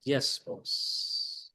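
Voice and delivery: whispered, resigned yes, boss; defeated yes-boss. Retold or imitated. Worn-out employee giving in to boss, whispered. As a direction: breathe the yes, boss out in quiet surrender. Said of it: "means saying".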